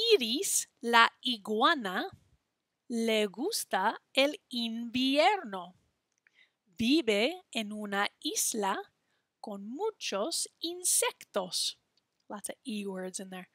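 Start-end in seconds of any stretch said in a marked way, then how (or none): 0.1-11.8 reading a rhyme. Shown as a demonstration. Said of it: "say this little rhyme".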